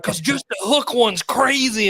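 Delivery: high, slow, emphatic imitated answer; excited yell. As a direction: draw it out as a thrilled holler. Retold excited answer from crew.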